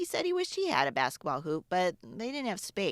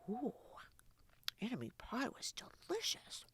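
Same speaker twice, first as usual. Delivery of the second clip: whispered, much softer, like a guilty confession; secret whisper; 5 semitones higher, 11 dB quieter than usual. Acted. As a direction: whisper the taste test as a secret. Secretly tasting the pie.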